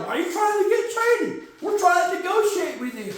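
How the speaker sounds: high-pitched pleading protest; comic high-voiced exasperation; exasperated plea. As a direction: plead in a high, put-upon voice. Acts out exasperated Bengals.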